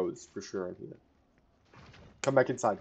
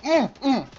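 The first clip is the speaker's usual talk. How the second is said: frantic high whine; 11 dB louder than usual. Real, not acted. Frantic noise in the fight.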